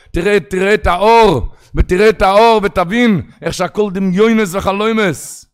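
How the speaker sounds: loud fervent preaching; rising sermon fervor; preaching at full force. Acted. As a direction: rouse the listeners toward repentance.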